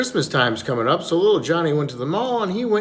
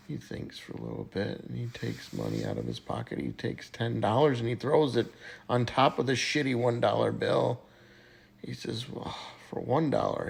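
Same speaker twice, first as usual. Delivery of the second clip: put-on much softer, low drunken mumble; drunk muttering; 5 semitones lower, 12 dB quieter than usual. Drunk Johnny muttering to himself.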